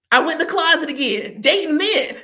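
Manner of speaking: cracking up at yourself; giggling confession; laughing. Real, not acted. Laughing at own joke.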